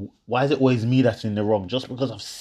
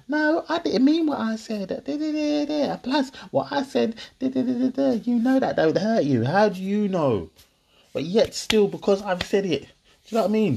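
much higher, mocking her protest; mock girlfriend voice; 14 semitones higher than usual. Mimicking his girlfriend's denial, much higher mocking voice. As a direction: whine it high like she would.

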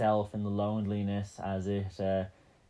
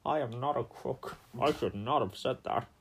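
a light Nixon impression; a lightly voiced Nixon, quieter than the radio voice; 4 dB quieter than usual. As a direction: deny it like Nixon. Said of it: "Hagrid to Nixon".

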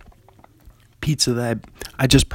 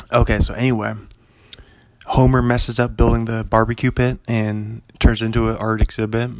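usual speaking voice

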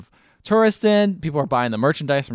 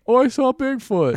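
high squeaky mock believer voice; mock witness; 11 semitones higher than usual. Mocking a random witness in a high silly voice.

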